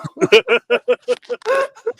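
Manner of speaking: squealing high laugh; helpless high giggle; high laughing fit. Real, not acted. Laughing at friend's accent.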